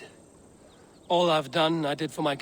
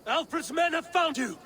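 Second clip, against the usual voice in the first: urgent shout; 13 semitones higher than usual. Acted. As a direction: yell to raise the alarm. Calling out an urgent warning.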